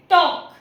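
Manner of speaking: टॉक said as tawk, the L left silent. Shown as a demonstration. Teacher to students, showing टॉक with a silent L. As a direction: say टॉक with no L sound at all. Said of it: "टल्क नहीं होता है वो".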